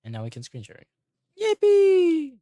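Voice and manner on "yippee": very high, loud squeal; long, piercing cheer held on one high note; high squealed yippee. Pretend. Cheering that screen share works, high squeal.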